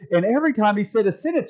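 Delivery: imitating a comedian, high and quick; comedian bit. Preacher retelling comedian's joke about sentences, exclaiming. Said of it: "the comedian I was watching".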